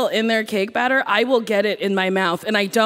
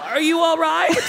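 exasperated disbelief; 7 semitones higher than usual. Retold or imitated. Mocking hopeless contestants on show.

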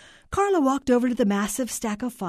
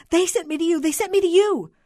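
high, loud tearful wail; sobbing; 11 semitones higher, 8 dB louder than usual. A narrator's reading. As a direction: sob it out insistently, over and over. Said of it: "the voice sobbed".

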